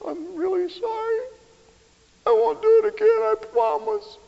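put-on very high little child's pleading apology; tearful child voice. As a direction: play a small crying kid promising to behave. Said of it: "Big tears coming out of the kids' eyes".

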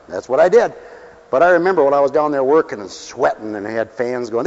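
usual speaking voice

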